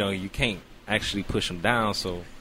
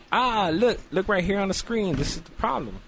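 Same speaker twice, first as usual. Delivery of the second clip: eager doctor; 10 semitones higher than usual. Retold or imitated. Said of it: "And then he goes".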